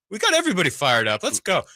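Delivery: hyped up. Real, not acted. Hyping up the radio audience.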